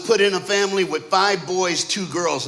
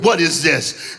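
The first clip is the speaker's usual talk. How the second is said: louder, menacing fatherly demand; mock-angry dad, louder; 2 semitones lower, 5 dB louder, slower than usual. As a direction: demand it like a furious father, acted. Angry dad, growl before it.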